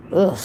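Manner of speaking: short groan of disgust; disgusted ugh. Real, not acted. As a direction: let out a fed-up groan of disgust. Disgusted at singer's voice.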